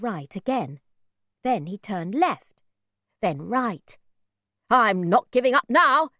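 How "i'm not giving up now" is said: determined declaration. A narrator's reading. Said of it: "my mother said".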